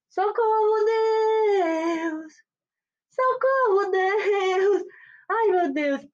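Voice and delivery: high, hammed-up cries for help to God; playful hammed-up terror, voice thin and high; mock panicked cry. Mock panic over UFO news.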